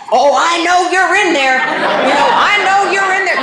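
high, loud singsong teasing; taunting. Retold or imitated. Teasing the boyfriend hiding behind the bathroom door, singsong taunt. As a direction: coax him out with a mocking lilt.

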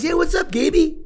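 high, loud imitation of a casual guard; guard greeting. Prison guard greeting the inmate casually, high and loud. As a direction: toss off a friendly guard's hello. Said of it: "here comes this man in uniform".

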